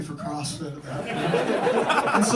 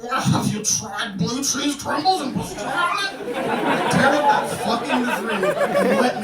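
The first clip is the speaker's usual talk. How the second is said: higher, louder, laughing through it; laughing rant; 8 semitones higher, 5 dB louder than usual. Real, not acted. Comic to the audience, giddy laughing rant. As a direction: get carried away by the bit. Mock advice, then ranting.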